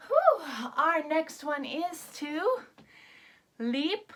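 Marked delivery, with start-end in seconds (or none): 0.1-0.5 much higher and louder exhale; mock-tired whew; relieved whew. Shown as a demonstration. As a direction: blow out like after a workout. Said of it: "pretend tired exhale".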